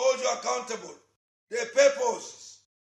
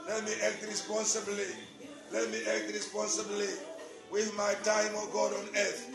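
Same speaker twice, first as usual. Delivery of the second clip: softer prayer over music, repeating pleas; fervent prayer; 2 semitones lower, 6 dB quieter than usual. Acted. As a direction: pray aloud, leading the church to God.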